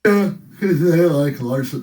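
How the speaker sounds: slow words through a yawn. Real, not acted. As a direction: talk while yawning. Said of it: "Sorry, I'm yawning".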